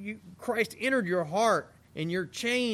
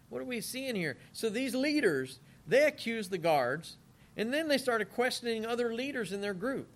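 ordinary talk

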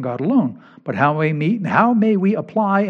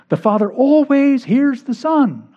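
emphatic high repeat; 9 semitones higher, slower than usual. Acted.

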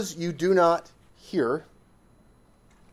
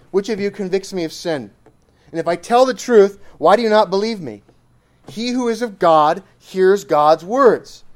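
loud, louder, driving the verses home; forceful scripture preaching; 3 semitones higher, 7 dB louder than usual. Acted. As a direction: preach Jesus' words with full force at the crowd. Preaches Jesus' words forcefully.

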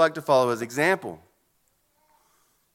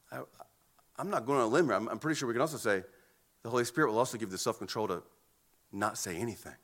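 much softer, fast and low; hushed aside; 7 semitones lower, 10 dB quieter, much faster than usual. Acted. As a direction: drop to a near hush. Preacher drops voice for silence.

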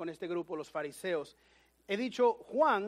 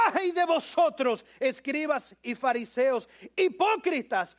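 shouting Jesus; 3 semitones higher, 4 dB louder than usual. Acted. Preacher voicing Jesus rebuking the Pharisees loudly. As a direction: call out the hypocrites. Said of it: "ahí dice".

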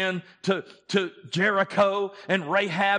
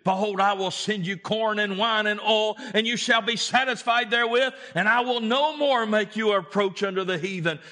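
high, loud fervent preaching; full-force preaching; 8 semitones higher, 5 dB louder than usual. Acted. Preacher to the congregation, fervent loud preaching. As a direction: drive Joel's call home to the church.

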